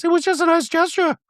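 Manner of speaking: high, prim voice for Dorit; Dorit impression. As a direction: play Dorit sweetly apologetic in a high voice. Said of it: "you can tell Dorit goes".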